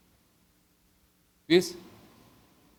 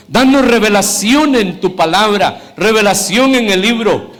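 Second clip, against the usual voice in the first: slow pleading prayer rising to a loud high cry; fervent prayer; 5 semitones higher, 7 dB louder than usual. Acted. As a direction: pray it out, building to a loud, high, pleading climax. Pastor leads closing prayer. (oración ferviente que crece en volumen y altura)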